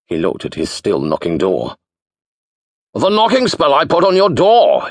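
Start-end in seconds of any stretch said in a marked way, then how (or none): 2.9-4.9 loud, high bellow as into a gale; bellowing wizard. A narrator's reading. Wizard explaining the spell, bellowing. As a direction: shout over a storm. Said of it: "he bellowed".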